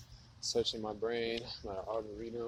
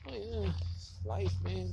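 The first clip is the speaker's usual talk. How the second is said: mock content people; 8 semitones higher than usual. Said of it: "They're just like".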